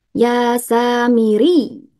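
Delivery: slow recitation, "samiri" pressed on "ri"; stressed final syllable. Shown as a demonstration. Teacher demonstrating naber, slow chant with stressed ending. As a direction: chant slowly and lean on the last syllable of "samiri". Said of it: "dengan naber".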